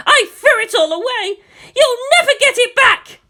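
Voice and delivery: very high, loud scream breaking into a cry; hysterical scream. A narrator's reading. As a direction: scream it cruelly, near tears. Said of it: "she screamed at him suddenly".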